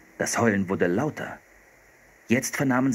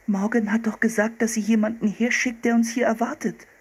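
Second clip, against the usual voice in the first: put-on much higher-pitched girl's voice; young girl Anne; 12 semitones higher than usual. Sister reminding brother that help was promised, high girlish voice.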